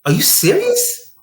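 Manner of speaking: high, slow shocked disbelief; shocked girlfriend. Retold or imitated. Girlfriend hearing his answer, high shocked disbelief. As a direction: squeal it in disbelief. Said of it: "and she said".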